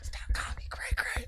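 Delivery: very soft, strained whisper; imitated angry kid. Little girl objecting to the nickname, whispered mock fury. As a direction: hiss it voicelessly like a fuming child. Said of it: "she kept going like this".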